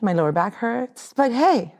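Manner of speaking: ordinary talk, nothing marked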